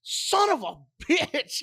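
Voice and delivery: imitating an exasperated host, high and slow through a laugh; mock frustrated curse. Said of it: "He was pissed off".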